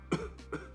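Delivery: short, soft coughs; small deliberate throat cough; demonstration cough. Shown as a demonstration. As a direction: cough lightly on purpose. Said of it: "techniques involve coughing or yawning".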